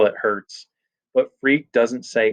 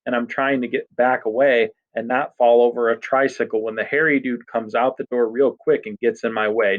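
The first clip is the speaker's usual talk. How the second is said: plain reading voice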